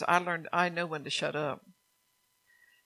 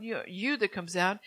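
ordinary talk

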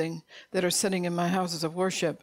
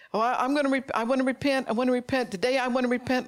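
high, louder frantic begging; desperate pleading; 6 semitones higher, 3 dB louder, faster than usual. Acted. Foolish virgin begging at the shut door, high frantic plea. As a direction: plead to be let in, voice raised and high.

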